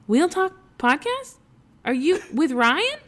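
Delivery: high, loud disbelieving question; retold excited surprise; astonished recognition. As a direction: imitate a fan suddenly recognising someone. Imitates listener recognising the podcaster.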